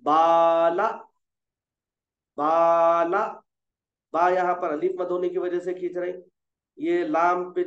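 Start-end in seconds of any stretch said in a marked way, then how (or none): 0.0-3.2 बाला sung out loud with the long aa held; drawn-out baala. Shown as a demonstration. Teacher reading बाला aloud for students, long vowel. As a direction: stretch the aa in बाला clearly. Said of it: "हम पढ़ लें यहाँ पर".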